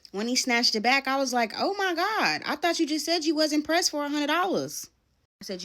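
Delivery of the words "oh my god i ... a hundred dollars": high, loud mock shock at him; sarcastic disbelief. Retelling a boyfriend's lie to viewers, high mock disbelief. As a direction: throw his own words back at him, incredulous. Mocking his claim about money.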